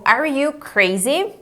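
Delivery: "Are you crazy?" slow, lifting at the end; teacher modelling a rising "crazy?"; question intonation. Shown as a demonstration. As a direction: let "crazy" rise as a question. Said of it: "Faz entonação de pergunta".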